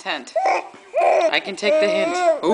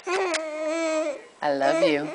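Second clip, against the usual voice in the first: tender; 6 semitones higher, 5 dB quieter, much slower than usual. Real, not acted. Mother tenderly to baby.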